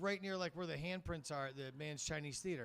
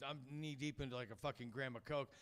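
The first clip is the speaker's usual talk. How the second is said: ordinary talk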